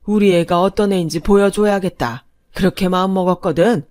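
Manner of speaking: put-on loud, crisp, cutting old lady; grandma scolding the rude parents in a clear ringing voice. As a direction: put them firmly in their place. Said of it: "카랑카랑한 목소리로".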